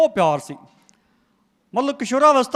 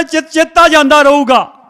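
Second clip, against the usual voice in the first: higher, louder, slow divine proclamation; booming voice of God; 6 semitones higher, 10 dB louder, slower than usual. Retold or imitated. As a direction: proclaim it as the Lord himself. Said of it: "ਪ੍ਰਭੂ ਕਹਿੰਦਾ".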